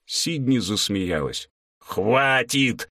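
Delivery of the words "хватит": wailing cry to stop; howling. A narrator's reading. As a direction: howl it out in protest. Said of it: "взвыл Лоуренс". (взвыл)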